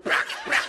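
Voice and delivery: unvoiced mouth clicks for handcuffs; handcuff click sound. Thief getting cuffed, voiced click sounds. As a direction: snap the cuffs shut with your mouth.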